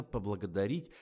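ordinary delivery, nothing marked

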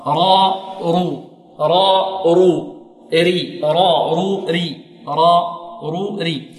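را and رو full-mouthed, ري thin; heavy and light raa drill. Shown as a demonstration. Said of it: "مفخمة". (تفخيم وترقيق الراء)

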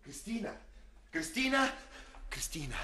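high call across a distance. Acted. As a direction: call her name urgently. Suitor calls her from afar.

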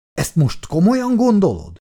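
astonished, high-pitched question; astounded. A narrator's reading. Lexa challenging Cosgrove in the stable, astounded. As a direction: ask it in open disbelief, pitch jumping up. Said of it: "képett el a nő".

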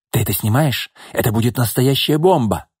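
plain reading voice

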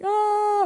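high-pitched groan of dismay; reluctant whine. Retold or imitated. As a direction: whine "ah" in mock protest.

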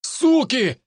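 very high shrieked curse; russian street thug shout. Acted. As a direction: yell the curse while running off. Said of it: "крикнул Кучерявый".

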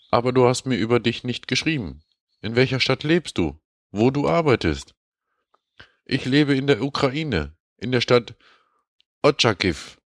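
high, sugary mock voice for a dating-scam mail; reading as scam woman. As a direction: read it as a coy stranger writing in broken German. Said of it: "Und zwar schreibt sie".

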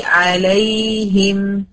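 عليهم with the ي held long; drawn-out ya. Shown as a demonstration. Teacher showing the class the longer ya, عليهم with ي drawn out. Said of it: "زمنها أطول من زمن إيه".

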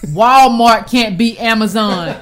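slow, every word of Walmart can't beat Amazon spelled out crisply; over enunciating. Shown as a demonstration. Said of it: "can y'all read my lips".

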